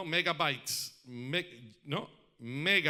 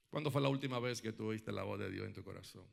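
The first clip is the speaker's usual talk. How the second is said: intimate appeal; 6 semitones lower, 7 dB quieter than usual. Real, not acted. Preacher asking the listeners about God's voice, soft and searching. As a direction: lean in and ask it gently, pausing.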